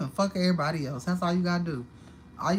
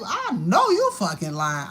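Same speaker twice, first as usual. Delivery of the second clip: loud, drawn-out disbelief; retold shock, loud and slow; outraged disbelief; 4 semitones higher, 8 dB louder, slower than usual. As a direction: exclaim it slowly, appalled and loud. Retold shock at spider on bed.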